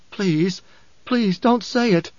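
high, slow, anguished begging; desperate pleading. A narrator's reading. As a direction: beg him to stop, voice lifted. Mother begs him to stop.

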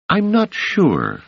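high, slow and unsure; Doris voice. Acted. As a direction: draw it out, doubtful.

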